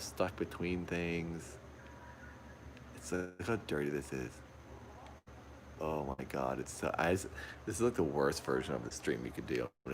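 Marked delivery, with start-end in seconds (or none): none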